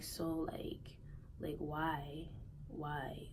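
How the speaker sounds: soft, breathless whisper of a question; eerily calm whisper. Retold or imitated. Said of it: "I was the calmest that I ever been".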